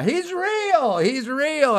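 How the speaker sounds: high, loud, drawn-out announcement; re-enacted excited proclamation; shouting to a crowd. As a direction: call it out high, as if to a roomful. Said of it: "sounded like a big crowd in the back".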